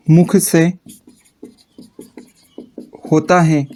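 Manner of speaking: slow, broken phrases with long pauses for writing; slow dictation. Shown as a demonstration. Teacher dictating definition to class, slow and paused. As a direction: dictate it so students can keep up.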